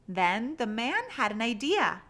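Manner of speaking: usual speaking voice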